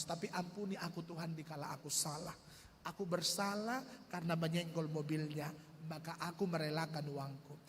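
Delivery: ordinary delivery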